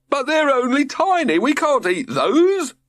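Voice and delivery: crying out in dismay. A narrator's reading. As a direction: protest in dismay. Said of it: "cried George".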